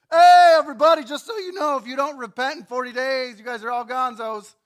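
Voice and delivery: high, loud town-crier call; mock proclamation. Jonah calling out doom to the whole city, loud mock proclamation. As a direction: shout it to the whole city, half-joking.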